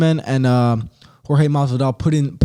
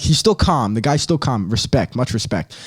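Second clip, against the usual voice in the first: normal talking voice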